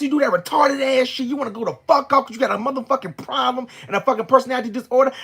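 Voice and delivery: sustained high, loud tirade; screaming. Retold or imitated. Woman cursing out her ex in the car, screaming. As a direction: rant at full volume without letting up. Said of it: "screaming at you and scaring you".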